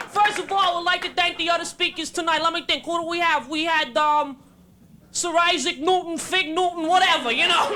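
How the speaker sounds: put-on thick Brooklyn accent, casual convention speaker; Albert Einstein, Brooklyn accent. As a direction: play a brash Brooklynite fumbling through a science speech. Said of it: "if Albert Einstein were from Brooklyn".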